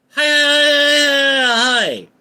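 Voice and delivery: loud, held, very high wail; sustained high-pitched holler; high drawn-out hi. Real, not acted. Drawn-out high outburst at chat.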